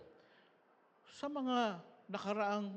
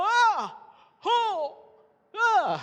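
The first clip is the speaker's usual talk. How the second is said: loud high taekwondo yells, shouted full out; martial arts kiai; 13 dB louder, slower than usual. Retold or imitated. Pastor copying his kids' taekwondo yells at the party, loud high shouts. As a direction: belt out sharp fighting shouts. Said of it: "Sumigaw talaga".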